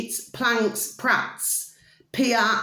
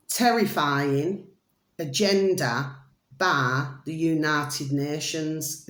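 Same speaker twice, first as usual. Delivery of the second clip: each word drawn out slowly; slow dramatic reading; 5 semitones lower, much slower than usual. Shown as a demonstration. Vlogger reading a video title to followers, slow and grave. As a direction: read the title as if it were a grim verdict. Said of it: "The video should read".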